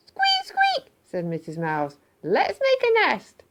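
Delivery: very high slow squeaky voice; squeaky mouse voice. Acted. Missus Mouse to Mister Mouse about the newspapers, high squeaky voice. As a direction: squeak out the idea in a tiny voice.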